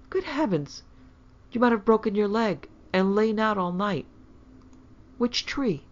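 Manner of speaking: high-pitched motherly alarm; aghast. A narrator's reading. Mother learning daughter climbed a tree, aghast. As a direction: exclaim in shock, pitched well up.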